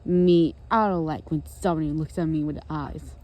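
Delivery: loud, low grump voice; low, forceful complaint; Grouchy. Acted. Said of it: "and he said".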